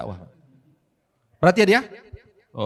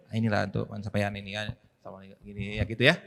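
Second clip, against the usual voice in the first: low, soft sly voice; hushed aside; 9 semitones lower, 8 dB quieter than usual. Retold or imitated. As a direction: say it quietly like a secret gift. Petugas zakat berbisik memberi hadiah. (suara pelan berbisik-bisik)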